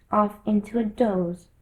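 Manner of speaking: plain reading voice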